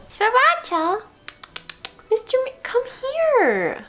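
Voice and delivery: high, slow calling of the cat. Real, not acted. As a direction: coax the kitty over.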